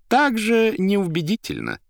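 high, loud, lecturing editor; образованный редактор. A narrator's reading. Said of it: "тонко улыбнувшись, возразил образованный редактор". (высокий громкий голос учёного спорщика)

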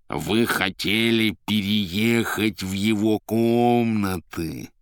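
slow, insinuating nasal drawl; nasal, soulful whine. A narrator's reading. Azazello questioning the informer, slow nasal mock sincerity. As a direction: draw it out sweetly through the nose. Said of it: "как можно задушевнее прогнусила Азазелла".

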